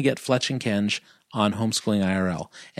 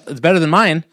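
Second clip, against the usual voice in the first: usual speaking voice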